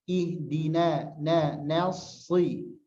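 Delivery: slow Ihdina with a light, thin na; slowly sounded thin na. Shown as a demonstration. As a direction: keep the na of Ihdina, na light, not heavy. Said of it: "huruf nun jangan tebal".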